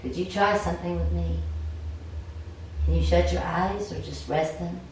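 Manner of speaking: usual speaking voice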